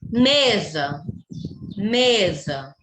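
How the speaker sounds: "Mesa" with a long, strong ME; slow teaching demo, held ME in "Mesa"; stressed first syllable. Shown as a demonstration. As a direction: lean on ME in "Mesa" and let sa fall. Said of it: "Mesa é a sílaba tônica".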